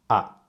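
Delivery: short, firm "ah" dropping sharply in pitch; falling tone. Shown as a demonstration. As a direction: drop "ah" all the way down. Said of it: "it goes all the way down".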